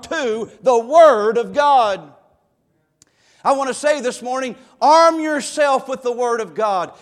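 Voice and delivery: rally the flock to take up the Word; pulpit exhortation. Acted.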